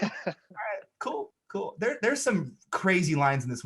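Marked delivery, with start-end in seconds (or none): 0.0-1.7 soft, higher-pitched murmur; meek mock reaction. Viewer reacting to the yelled line, meek. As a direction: shrink back from the yell, softly.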